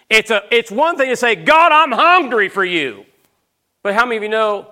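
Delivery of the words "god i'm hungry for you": loud, high, drawn-out plea to God; fervent cry. Acted. Preacher voicing a worshipper crying out to God, fervent. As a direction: cry it out with full force, stretching the words.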